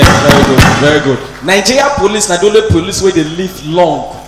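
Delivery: ordinary talk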